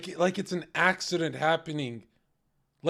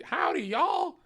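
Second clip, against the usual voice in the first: high, hammed-up cowgirl twang; cowgirl accent; 11 semitones higher, slower than usual. Mocking women's second joke, high exaggerated cowgirl accent. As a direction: holler a mock cowgirl accent. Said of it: "always a cowboy, cowgirl accent".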